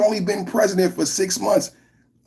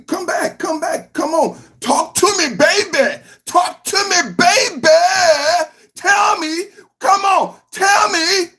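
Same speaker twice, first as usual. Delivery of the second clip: mocking taunt; 12 semitones higher than usual. Taunting absent caller to come back.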